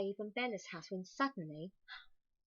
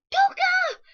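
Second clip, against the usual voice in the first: very high, loud joyful shout; child shrieking for his lost toy; 17 dB louder than usual. A narrator's reading. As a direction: yell the name with pure joy. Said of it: "shouted Dave".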